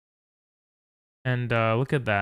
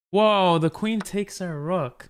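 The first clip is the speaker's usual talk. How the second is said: slow, drawn-out dismay, higher and louder; shocked whoa; 6 semitones higher, 5 dB louder, slower than usual. Real, not acted. Streamer sees his rook taken, shocked dismay. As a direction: recoil at the lost rook, stretching it out.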